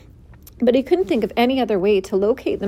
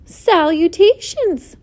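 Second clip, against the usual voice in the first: high, slow, louder greeting; thin voice repeating; 8 semitones higher, 5 dB louder, slower than usual. A narrator's reading. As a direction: sing out the greeting, drawn out. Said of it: "repeated the voice".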